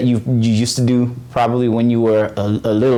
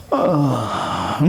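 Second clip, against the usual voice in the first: sleepy yawn; 2 semitones lower, 4 dB quieter than usual. Shown as a demonstration. Teacher yawning along with class, softly sleepy. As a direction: yawn as if falling asleep. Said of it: "feel a little sleepy while you're doing it".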